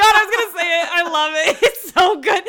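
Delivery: loud, high laughing burst; gleeful laughter over the words. Real, not acted. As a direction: laugh it out, delighted. Excited laughing recognition.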